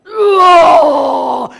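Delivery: loud lion-like roar; battle roar; roaring shout. Retold or imitated. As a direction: roar at the spirit with full force. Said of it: "And I started to roar".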